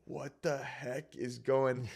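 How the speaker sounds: slow, stunned disbelief; retold freak-out. Unbelieving friend watching the lizard revive, tripping out. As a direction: imitate a friend tripping out, drawn out. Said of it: "was tripping out".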